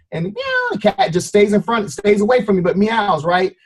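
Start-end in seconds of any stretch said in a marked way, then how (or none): 0.2-0.7 high, thin imitated meow. Imitating the stray cat's call, a high thin meow. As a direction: mew like a small wary cat.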